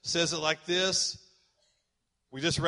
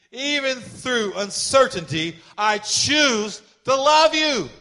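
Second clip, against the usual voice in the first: slow, rising from plain to loud and higher; building preached prayer; 4 semitones higher, 6 dB louder, slower than usual. Acted. Pastor leading the church's vow, building to loud. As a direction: climb each line toward the last.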